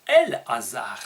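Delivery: "El-Hazar" repeated low, with a loud, separate "El"; Hebrew form El-Hazar. Shown as a demonstration. Teacher repeating the Hebrew name for listeners, "El-Hazar" in two firm parts. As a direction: punch "El", then let "Hazar" follow distinctly. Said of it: "on dit El-Hazar".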